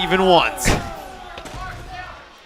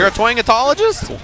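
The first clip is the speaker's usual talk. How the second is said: high rising question; playful disbelief; 9 semitones higher, 3 dB louder than usual. Real, not acted. Commentator querying partner's twang expertise, playful disbelief. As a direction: query it in amused incredulity.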